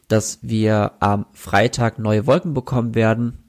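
ordinary talk, nothing marked

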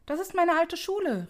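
high, eager exclamation; old man recognizing his school with a cry; exclaiming. A narrator's reading. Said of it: "rief Scrooge".

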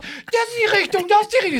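very high, squeaky mock-bossy voice; mocking a cocky jogger with a tiny high voice; arrogant little Rumpelstilzchen. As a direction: squeak out the directions like a know-it-all goblin. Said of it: "der immer noch arrogant macht".

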